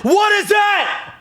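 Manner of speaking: slow, very high, loud shriek; shocked shout. Real, not acted. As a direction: yell it out as the shock lands. Porn appears under his tweet.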